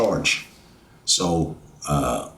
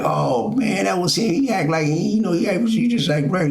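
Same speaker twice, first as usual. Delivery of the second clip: lightly voiced inmates, pitched much higher; imitating the inmates; 11 semitones higher than usual. Inmates praising him to the others, high mock voice. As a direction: mimic them talking him up, high and bright-eyed. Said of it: "And they was like".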